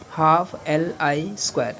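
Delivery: slow, word by word: half L I square; slow dictation. Shown as a demonstration. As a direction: spell out half L I square slowly for notes. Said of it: "कितनी energy store करेगा वो?". (धीमे-धीमे लिखवाते हुए)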